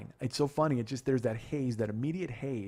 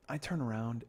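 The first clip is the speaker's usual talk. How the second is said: usual speaking voice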